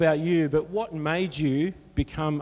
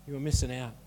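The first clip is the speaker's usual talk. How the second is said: low, soft "You are missing out"; hushed, lowered restatement; quiet repeat; 6 semitones lower, 7 dB quieter, faster than usual. Real, not acted. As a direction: drop right down to let it sink in.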